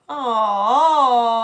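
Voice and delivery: long, loud, drawn-out aww; cooing sympathy. Real, not acted. Seeing the dog woken from his nap, drawn-out coo. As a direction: coo it out at length.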